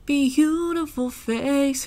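slow, drawn-out self-admiring; mock vain. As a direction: savor it, playfully vain. Mock vanity about her face.

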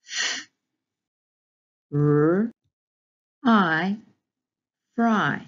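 loud separate F, r, I, then fry; sounding out phonics. Shown as a demonstration. Teacher modelling segmenting, loud separate sounds. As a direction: tap out each sound, then blend fry. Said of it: "sound out each of these graphemes".

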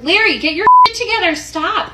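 loud, high, fast scolding; retold angry outburst at Larry; scolding shout. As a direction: snap at him to get it together.

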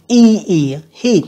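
"E, E, heat" with the ee held long; teacher modelling the long vowel in "heat"; drawn-out long ee. Shown as a demonstration. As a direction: stretch the ee in "heat". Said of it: "các bạn phải đọc hai âm Y lần".

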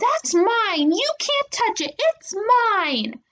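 very high, possessive tantrum; little sister screaming. A narrator's reading. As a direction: shriek to keep others off. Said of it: "Angelina screamed".

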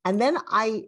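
ordinary delivery

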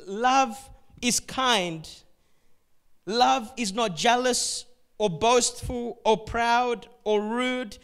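loud, slow, each word hammered; forceful preaching. Acted. Preacher proclaiming First Corinthians, loud and slow. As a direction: proclaim it over the room.

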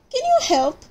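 high, pleading call upward; urgent call for help. Acted. Park keeper asking the owl to help the bird, high urgent plea. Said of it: "called Percy".

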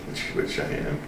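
usual speaking voice

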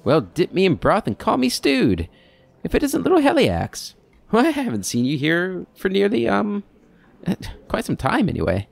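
put-on high, jolly old-timer voice; chatty old man. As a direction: play a doddering old keeper delighted to see him. Theoden greets Heliax.

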